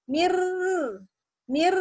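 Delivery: "Mir" with a long double trill; doubled R. Shown as a demonstration. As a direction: hold the rolled r in "mir". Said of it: "kan ada dua ro tuh, bertasdid".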